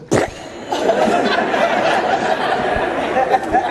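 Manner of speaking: breathy high-pitched laughter; real helpless laughter; long high laugh. Real, not acted. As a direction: laugh hard at the memory. Laughing at own story.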